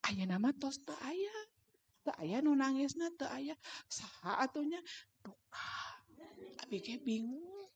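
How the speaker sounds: very soft, hushed exchange; gentle, near-whispered question and answer; softened voice. Retold or imitated. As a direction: act out a murmured talk between parent and child. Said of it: "Jadi suara saya tuh dipelankan".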